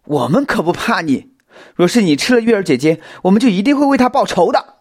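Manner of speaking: high shout, building and speeding up; loud defiant yell. A narrator's reading. Xiao Xin vowing revenge to the creature, loud yell. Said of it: "小新大声地吼道".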